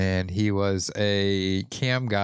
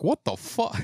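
Christopher Walken impression; 9 semitones higher than usual. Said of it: "He's like".

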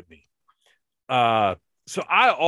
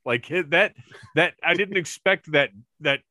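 normal talking voice

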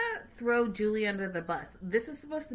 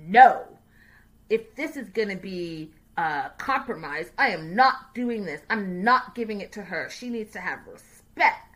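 loud, mock-angry father putting his foot down; imitating angry father; 8 dB louder than usual. Imitating the father refusing the compromise, louder mock anger. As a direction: bark a mock refusal like an indignant dad. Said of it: "he got so angry and, like, passive-aggressive angry".

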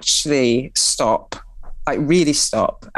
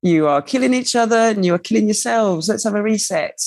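lightly voiced universe, pitched higher; higher, mock scolding of humanity; the universe speaking; 6 semitones higher than usual. Universe scolding humanity for a reset, lightly voiced in higher pitch. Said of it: "the universe is like".

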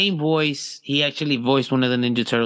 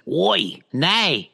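mock Australian oi and nay; brash Australian Oi! Nay!; hammed-up Australian; Australian accent; 4 semitones higher than usual. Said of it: "That's me saying nay".

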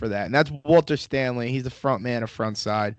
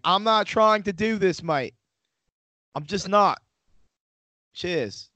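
higher-pitched mock English accent; 8 semitones higher, slower than usual. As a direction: put on a drunk visitor's imitation English accent. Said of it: "talking with an English accent".

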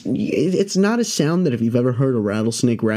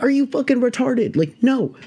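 high-pitched incredulous retort; 12 semitones higher than usual. Retold or imitated. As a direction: shoot the idea down in disbelief, pitched way up. Scolds friend's foolish idea.

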